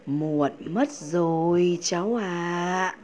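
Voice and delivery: slow, drawn-out goodbye from the bird-grandmother; a sad faraway farewell, stretched out; bird's farewell voice. A narrator's reading. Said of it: "chỉ có tiếng chim trả lời".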